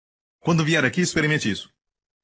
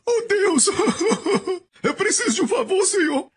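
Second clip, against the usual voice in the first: high, laughing, whiny begging to God; mock a needy pray-er in a squeaky voice; mock pleading prayer. (oração suplicante caricata, aguda e rindo)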